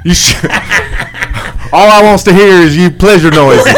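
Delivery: put-on thick Southern accent. Host playing cowboy gum pitchman, thick Southern accent. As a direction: push the Southern accent for laughs. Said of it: "Can we do a thick Southern accent?".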